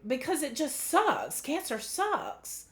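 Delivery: high, strained upset; a little emotional. Real, not acted. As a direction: let the upset lift the voice. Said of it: "I'm getting a little emotional".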